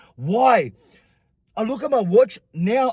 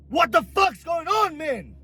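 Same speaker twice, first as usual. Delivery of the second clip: high, slow retold wail; acted-out high-pitched exasperation; outraged protest; 9 semitones higher, slower than usual. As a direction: draw it out in pained disbelief. Acted outrage at painful treatment.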